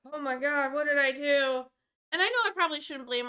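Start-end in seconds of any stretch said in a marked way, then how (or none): 0.1-1.6 alarmed. Retold or imitated. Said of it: "And I'm like".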